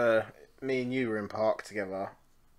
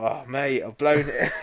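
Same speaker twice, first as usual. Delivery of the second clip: laughing through it; 3 semitones higher, slower than usual. Real, not acted. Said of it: "And I was like".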